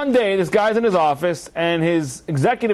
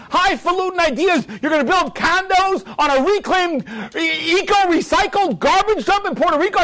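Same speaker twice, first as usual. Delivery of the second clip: screaming father; 11 semitones higher than usual. Retold or imitated. Father screaming at his son in the office. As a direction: berate the ungrateful son.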